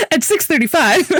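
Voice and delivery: laughing through the words; giggling as she says it. Real, not acted.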